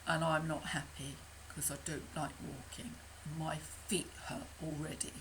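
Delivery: soft, low, sulky complaining; grumbling low duck. Acted. Lucky Ducky complains to friends, soft low sulk. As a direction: moan about sore feet.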